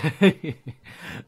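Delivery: loud, high burst of laughter; big gloating laugh. Real, not acted. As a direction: let out a triumphant laugh. Gloating after England lost.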